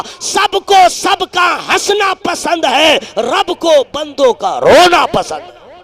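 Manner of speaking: very loud, high, slow oratory; sustained chanting sermon shout; full-force preaching. Real, not acted. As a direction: thunder it out to the crowd, stretching the words.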